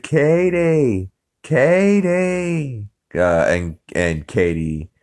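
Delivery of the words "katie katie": calling out. Retold or imitated. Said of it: "He's like".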